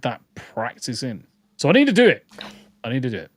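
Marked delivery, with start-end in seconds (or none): 1.6-2.3 louder, higher burst of determination; emphatic resolve. Real, not acted. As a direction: insist on it with a sudden push. Emphatic resolve about practising.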